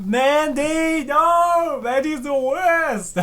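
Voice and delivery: high, loud, drawn-out no; loud high protest, slowed; dismayed shout. Real, not acted. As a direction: yell at the screen in dread. Commentator groans at Mandy again.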